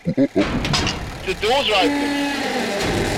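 slow, drawn-out high voice; slow spooky guard. Acted. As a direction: drag every word out slowly. Guard character answers door.

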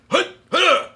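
sharp high call to start; sensei's call. Retold or imitated. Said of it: "the sensei goes".

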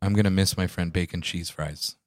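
hammed-up grief for a fast food item; mock eulogy. Mock eulogy for discontinued McDonald's fries, quiet. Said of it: "I'm gonna do a quick eulogy for it".

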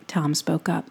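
usual speaking voice

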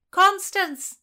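high, loud call of a name. A narrator's reading. Philomena calls Constance from a distance, loud. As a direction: call to her across the dark. Calling her name from afar.

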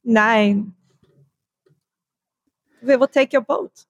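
put-on comic German-accented official; mock German accent. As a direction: play the German confiscating a boat. Voicing Germans seizing a boat.

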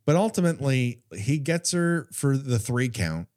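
normal talking voice

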